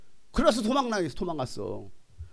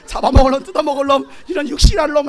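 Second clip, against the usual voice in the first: loud, high string of insults; cursing tirade; 7 semitones higher, 10 dB louder than usual. Shown as a demonstration. Preacher demonstrating curses at demons, loud tirade. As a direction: pile up curses with gusto. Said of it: "하면서 다 욕하세요".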